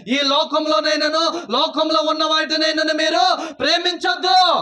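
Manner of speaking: loud, high, shouting sermon at full force; fiery preaching. Acted. Pastor to congregation, fiery shouted preaching. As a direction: preach it out hard to the church.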